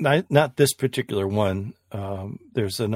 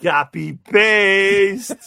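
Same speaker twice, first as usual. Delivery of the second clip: excited squeal; 12 semitones higher, 11 dB louder, much slower than usual. Real, not acted. Gushing over easy import trick.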